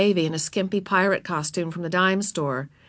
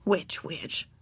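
soft, lower musing; private thought; 4 semitones lower, 8 dB quieter than usual. A narrator's reading. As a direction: murmur it to yourself. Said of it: "thought Ramona".

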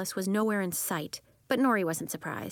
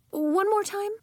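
much higher, louder ask; high teacher voice; 13 semitones higher, 5 dB louder than usual. Acted. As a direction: lift it high and bright. Teacher asks again.

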